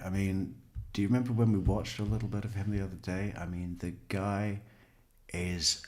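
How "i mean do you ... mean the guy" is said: ordinary talk, nothing marked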